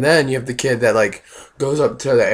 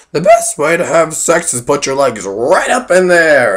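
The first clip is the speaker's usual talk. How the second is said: loud, higher mock old lady; old coworker; 5 semitones higher, 8 dB louder than usual. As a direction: play the blunt old coworker, louder. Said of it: "this old lady walks up".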